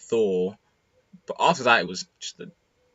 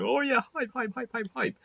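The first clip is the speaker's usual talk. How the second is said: retold excited shouting; 14 semitones higher, 3 dB quieter than usual. Retelling the friends' hype at the announcement, mock shouting. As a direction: imitate hyped-up friends yelling in a strained high voice. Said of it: "shouting at each other".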